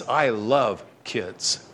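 very slow, soft and low; tender affection. Retold or imitated. Jesus with children in his lap, tenderly affectionate. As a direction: draw it out softly, savoring each word.

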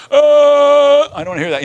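high strained yell, then plain words; hammed-up refusal to listen; mock yelling crowd. Crowd covering their ears against Stephen, mock yelling. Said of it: "people coming at you going".

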